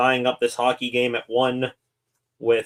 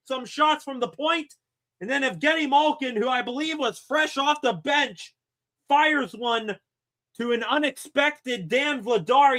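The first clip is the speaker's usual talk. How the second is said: live play-by-play; 13 semitones higher than usual. Acted. Streamer calling the Penguins goal for viewers, excited play-by-play. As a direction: call the tying goal like a broadcaster.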